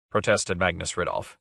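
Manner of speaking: ordinary delivery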